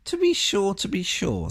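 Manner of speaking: flustered faun. A narrator's reading.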